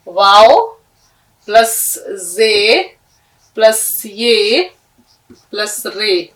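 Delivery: slow, spaced letter-by-letter dictation. Shown as a demonstration. Teacher spelling वजीर slowly for students. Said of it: "अलग अलग करके तोड़ कर इसको".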